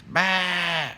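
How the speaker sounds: high, loud imitated bleat; little tiger's loud baa; sheep bleat. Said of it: "And he says".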